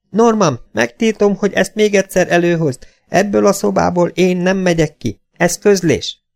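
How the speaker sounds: high-pitched, indignant mother voice; high, insistent maternal order; imperious mother. A narrator's reading. Mother figure forbids son.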